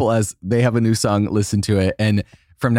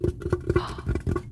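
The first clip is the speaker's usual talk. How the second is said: delighted gasp; 9 dB quieter than usual. Acted. Shows off Stanley Cup.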